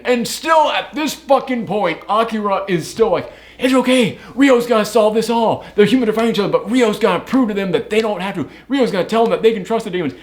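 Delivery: high, bright mocking of blind faith; mock naive optimism. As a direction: ham up the naive hopeful friend, pitched high.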